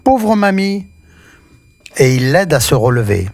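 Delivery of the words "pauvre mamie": put-on high, bright childlike exclamation of pity; child's cry. A narrator's reading. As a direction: cry out with pity for the old lady. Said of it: "Petit Pierre s'écrie".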